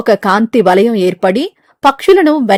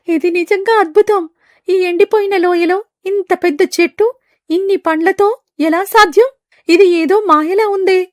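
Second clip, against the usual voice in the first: high, awed bird voice marvelling at fruit; amazed bird; 9 semitones higher than usual. Acted. Amazed bird gazing at the glowing fruit tree. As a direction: gasp in wonder at the tree.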